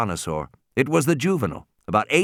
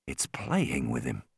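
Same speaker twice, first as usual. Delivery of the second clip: thought; 11 semitones lower, 10 dB quieter than usual. A narrator's reading. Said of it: "Grant thought".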